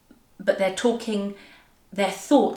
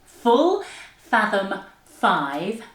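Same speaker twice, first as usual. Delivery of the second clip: very slow, separated, swooping words; tongue-twister demo; much slower than usual. Shown as a demonstration. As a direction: articulate each word by itself with big intonation. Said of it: "And again".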